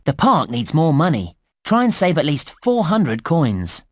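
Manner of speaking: ordinary delivery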